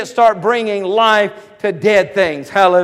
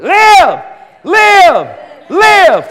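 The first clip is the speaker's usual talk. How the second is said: high, very loud one-word shouts; commanding shout; 9 semitones higher, 8 dB louder, much slower than usual. Shown as a demonstration. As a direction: shout it as a command over something dead. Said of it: "I want you to shout over it".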